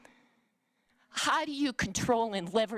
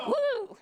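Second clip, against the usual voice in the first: mock woo. Real, not acted. Shocked by Venmo charge.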